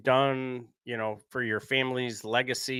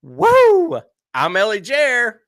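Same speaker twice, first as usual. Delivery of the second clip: ringmaster; 4 semitones higher, 8 dB louder than usual. Pretend. Podcast opening announcer intro.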